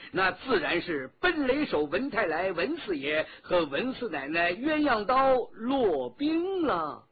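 loud, emphatic flattery building to the names; grand, effusive name-dropping compliment; flattering praise. Acted. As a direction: build admiringly, then draw out the final name high. Escort flatters couple, building to their names.

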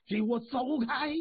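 音调拔高的一声喝喊，音量不大; 说书人学仙人喝斥，调门抬高. A narrator's reading. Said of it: "是一声喝喊". (一声喝喊，点到为止)

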